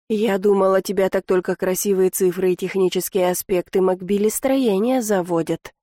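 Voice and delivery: usual speaking voice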